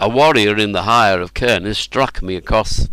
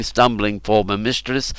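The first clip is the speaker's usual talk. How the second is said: ordinary delivery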